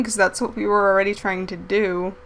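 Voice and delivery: normal talking voice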